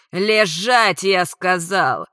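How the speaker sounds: loud, drawn-out angry order; harsh command. A narrator's reading. Attacker holding down struggling woman, commanding. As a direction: force out each word slowly and loudly.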